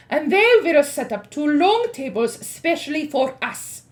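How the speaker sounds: high, loud commanding witch. Acted. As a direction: boom out orders like a witch queen. Grand High Witch addresses witches.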